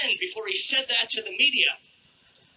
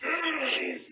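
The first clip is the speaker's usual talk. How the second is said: long, phlegmy throat clear; 4 semitones higher, 4 dB quieter than usual. Acted. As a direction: clear the throat grossly mid-flirt. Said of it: "clearing your throat is just disgusting".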